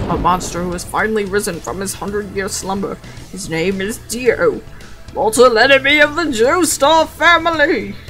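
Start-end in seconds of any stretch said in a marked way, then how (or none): 5.1-8.0 loud, hammed-up villain declaration; dramatic proclamation. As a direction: boom it out theatrically.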